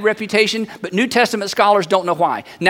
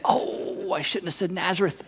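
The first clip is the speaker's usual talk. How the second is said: much softer, hushed self-reproach; regretful inner thought; 2 semitones lower, 11 dB quieter than usual. Acted. Philip realizes his mistake, regretful aside. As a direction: think aloud under your breath, regretting it. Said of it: "Philip is thinking".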